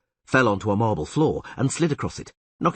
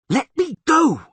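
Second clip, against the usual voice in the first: high, loud desperate scream; 8 dB louder, slower than usual. A narrator's reading. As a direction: scream to be released. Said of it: "she screamed".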